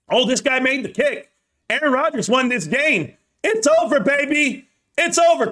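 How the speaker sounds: sports fan celebrating a last-second win, excited; excited call. Real, not acted. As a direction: yell it like the game just ended. Rodgers' kick wins the game live.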